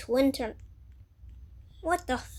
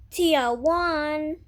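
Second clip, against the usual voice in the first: normal talking voice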